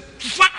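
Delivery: sharp high loud whoosh for a sword blow; sword swish. Acted. Preacher acting out the sword blow, loud high whoosh. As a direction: slash the air with a loud high "fua".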